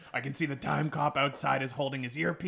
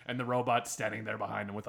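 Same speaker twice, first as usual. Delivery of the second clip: normal talking voice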